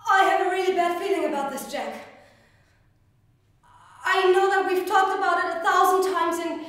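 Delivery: high, loud, urgent plea; anxious pleading. Acted. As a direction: beg a partner not to go. Acted plea not to fly.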